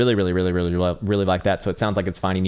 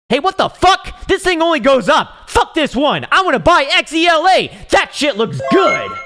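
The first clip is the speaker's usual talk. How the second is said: much higher, loud, put-on hype voice; mock hyped buyer; 8 dB louder than usual. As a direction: yell like a giddy dumb investor. Mocks a hyped Microsoft buyer.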